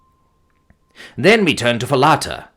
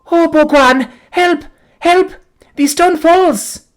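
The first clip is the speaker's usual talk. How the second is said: terrified cry; 6 dB louder, slower than usual. A narrator's reading. Girl crushed by closing door.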